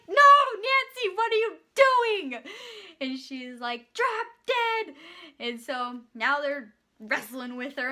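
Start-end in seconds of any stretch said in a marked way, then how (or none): 0.1-2.4 high, loud, drawn-out reenacted shout; acted horrified yell. Worker sees Nancy go to bite the cop, horrified yell. 3.9-4.9 high-pitched imitation of defiance; acted defiant outburst. Nancy refuses help from cop and worker, defiant. Nancy snaps back while restrained.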